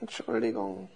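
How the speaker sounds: geflüsterte, scheue Entschuldigung; leise geflüstert. Acted. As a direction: zaghaft flüstern. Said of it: "sagte leise". (kleinlaut geflüstert)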